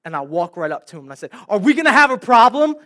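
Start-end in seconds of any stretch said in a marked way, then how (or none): 1.5-2.8 much higher and louder, half laughing; loud, laughing dare; loud challenge. Retold or imitated. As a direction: square up to him with a laugh in it. Confronting the angry man.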